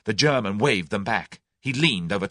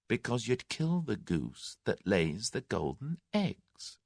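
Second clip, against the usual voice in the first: softer, slow and deliberate; quiet reply; 2 semitones higher, 8 dB quieter, slower than usual. A narrator's reading. Tommy quietly warning his captors, soft and slow. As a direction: lay it down softly, slowly, sure of himself. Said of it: "replied Tommy quietly".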